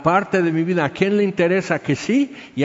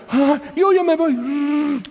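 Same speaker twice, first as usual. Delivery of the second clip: high, slow, drawn-out mock panic; loquita en pánico; 11 semitones higher, slower than usual. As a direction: squeal it high, hammed-up, as if fleeing. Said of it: "otra así que se aloca y la loquita". (pánico exagerado con voz muy aguda)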